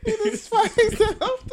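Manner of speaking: high, slow laughing words. Real, not acted. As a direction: laugh through the line. Laughing retelling.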